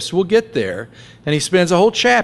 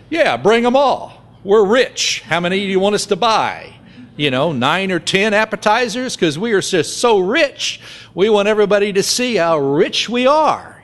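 higher, hammed-up bragging diner; sarcastic showoff; 6 semitones higher than usual. Preacher retells his restaurant joke, sarcastic higher bragging. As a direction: play the rich show-off boasting to the waiter. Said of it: "And the joke is".

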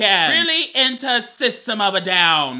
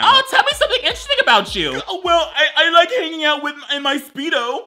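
mock young dater; 8 semitones higher, faster than usual. Mocking a nineteen-year-old dater.

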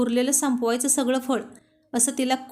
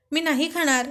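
a higher, pouting refusal; stubborn; 4 semitones higher than usual. A narrator's reading. Wife refusing food to her husband, pouting. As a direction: dig in your heels, childlike. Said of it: "ती पुन्हा हट्ट करत म्हणाली".

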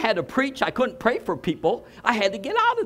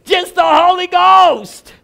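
loud, slow crying out; ecstatic cry; 8 semitones higher, 11 dB louder, slower than usual. Real, not acted. Scene: preacher overcome before the congregation, crying out. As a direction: wail it out under the spirit.

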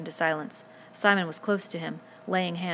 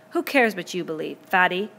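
loud, angry taunt; a touch of anger, hitting the last word; 7 dB louder than usual. A narrator's reading. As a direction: throw the insult at him. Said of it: "unaccountably angry".

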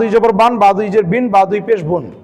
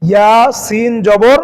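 teacher sounding out each letter, unhurried; slow, careful letter-by-letter reading; slow spelling demo; much slower than usual. Shown as a demonstration. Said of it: "এভাবে পড়তে পারি".

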